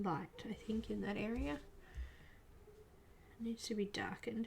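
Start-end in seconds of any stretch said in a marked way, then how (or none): 3.4-4.5 whispering. Real, not acted. Quiet painting commentary.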